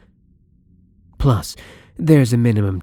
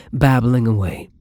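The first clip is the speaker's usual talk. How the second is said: ordinary talk, nothing marked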